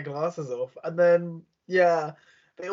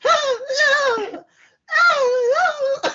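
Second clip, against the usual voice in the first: high, loud mock crooning; mock singer gesturing; 7 dB louder, much slower than usual. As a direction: ham up a wailing singer, much higher and louder. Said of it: "She was like".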